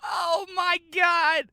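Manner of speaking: high, slow, laughing shout; laughing in disbelief. Real, not acted. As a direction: burst out laughing in shock. Laughing at the stabbing line.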